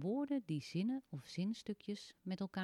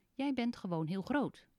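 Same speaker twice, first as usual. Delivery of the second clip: little man's voice; 5 semitones higher, 5 dB louder than usual. Acted. Little man contradicts Teun.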